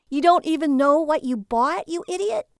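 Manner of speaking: high, loud scornful outburst; incredulous, insulting wife; angry. A narrator's reading. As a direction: berate him with rising pitch. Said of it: "Lily angrily sashed into the bathroom".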